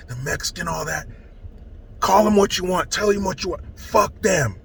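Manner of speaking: usual speaking voice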